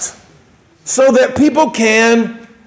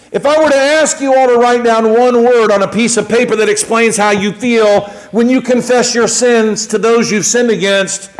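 ordinary talk, nothing marked

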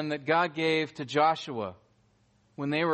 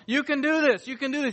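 high-pitched mock pep talk; motivational speaker; 8 semitones higher than usual. Preacher mimicking a motivational speaker giving pep talks, peppy and high. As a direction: cheer them on like a pep-talk coach. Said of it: "some motivational speaker giving some pep talks".

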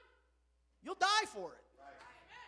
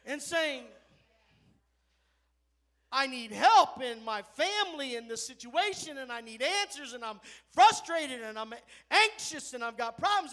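preacher reliving a weeping plea; retold crying out; 9 dB louder than usual. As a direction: cry out for help from a heavy heart. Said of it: "Tierra started weeping and s-, crying out".

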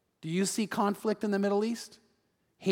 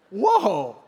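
louder, drawn-out excited whoa; 5 dB louder than usual. Acted. Sarah reacts to the thought of pleasure, excited. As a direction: play an excited old wife's reaction. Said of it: "And she's excited".

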